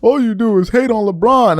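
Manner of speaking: a hint of a whiny fan calling him a hater; mildly mock the fans' accusation; mock accusing fan. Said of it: "And when I said it".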